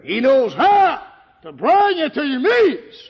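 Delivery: high, loud, slow preacher's cry; preaching at full force. Acted. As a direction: proclaim it to the congregation. Preaching climax.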